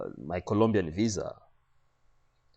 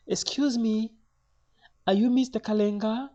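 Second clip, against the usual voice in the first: high-pitched imitation of a Spanish lady; high Spanish-accented clerk; Spanish lady official; 4 dB louder than usual. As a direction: put on the lady's high, querying voice. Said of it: "another lady asks in a Spanish accent".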